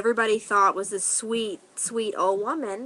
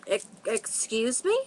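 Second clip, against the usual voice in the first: halting, rising disbelief; taken aback; 4 dB quieter than usual. Retold or imitated. Teller recalls hearing her friend accused of being on dope, taken aback. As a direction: stammer it, incredulous, ending on a rise. Stunned at an insulting question.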